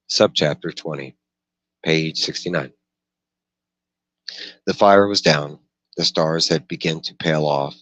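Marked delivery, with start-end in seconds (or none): none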